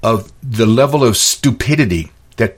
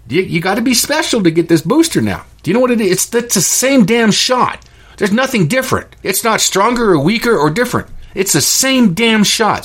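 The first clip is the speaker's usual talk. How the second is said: much higher, hammering every phrase; exasperated rant; 10 semitones higher than usual. Real, not acted. Vlogger railing at viewers about boosters, high exasperated rant. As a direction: push it up in disbelief.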